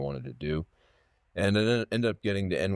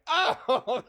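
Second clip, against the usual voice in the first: laughing mock gasp; 3 dB louder than usual. Students reacting to teacher.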